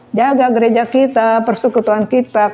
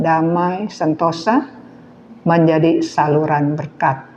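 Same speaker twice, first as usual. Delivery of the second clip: closing prayer; 5 semitones lower than usual. Acted. Preacher leads closing prayer.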